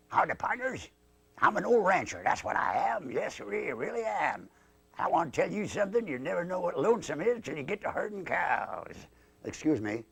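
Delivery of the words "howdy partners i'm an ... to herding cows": higher-pitched cowboy dummy voice; old rancher. Acted. Old rancher greets his partners, folksy high-pitched voice.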